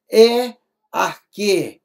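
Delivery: arque drawn out, syllable by syllable; reading it out slowly. Shown as a demonstration. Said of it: "Então".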